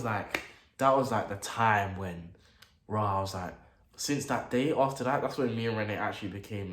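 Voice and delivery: ordinary talk, nothing marked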